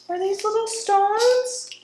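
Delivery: gasp, then a high, slow, amazed question; high, drawn-out wonder; delighted gasp. Real, not acted. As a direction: marvel at the little stones. Discovering stones in the box.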